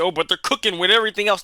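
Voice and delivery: quick, put-on voice of a fan; mock fan. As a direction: brush it off like a starry-eyed fan. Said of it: "And you might say".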